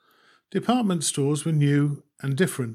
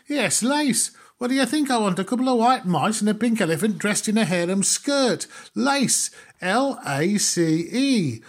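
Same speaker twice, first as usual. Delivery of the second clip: exasperated sarcasm; 7 semitones higher than usual. Acted. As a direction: spell it out as if to a fool. Customer spelling out lace in anger.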